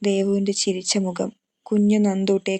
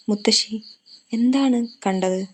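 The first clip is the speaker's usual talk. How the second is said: trembling voice; 2 semitones higher than usual. A narrator's reading. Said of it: "ദേവൻ വിറയുന്ന ശബ്ദത്തിൽ ചോദിച്ചു".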